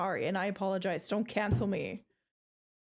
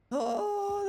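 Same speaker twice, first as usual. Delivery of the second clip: startled; 10 semitones higher than usual. Real, not acted. Jumpscare during horror game.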